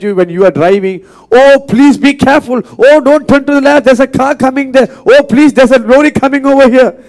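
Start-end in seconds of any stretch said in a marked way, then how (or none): 1.3-7.0 higher, louder anxious backseat nagging; a panicky passenger nagging the driver, higher and louder; nagging nervous passenger. Retold or imitated. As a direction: fret at the driver like a scared passenger. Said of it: "You keep on nagging you like that".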